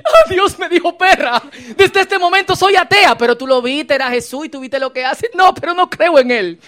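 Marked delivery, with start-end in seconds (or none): 0.0-3.2 higher, louder mock outrage through laughter; loud laughing mock offense; mock indignant, laughing. Woman's mock indignant reply. 5.3-6.6 louder, higher huffy denial; defiant mock refusal; stubborn retort. Woman's stubborn retort acted.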